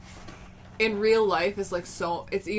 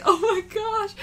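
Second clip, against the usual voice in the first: high squeal; 13 semitones higher, 4 dB louder, slower than usual. Retold or imitated. Reacting in high voice.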